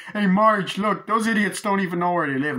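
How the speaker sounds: high, loud, cocky mock shout; calling out. Husband tells Marge about the mob, high loud shout. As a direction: call it out loudly in a high voice.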